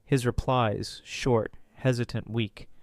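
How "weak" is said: ordinary delivery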